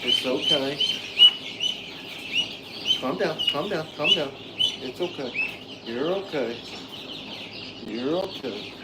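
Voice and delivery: soft, hushed reassurance; calm a nervous animal, softly; soothing. Real, not acted. Owner to a worked-up duck in hand, soothing.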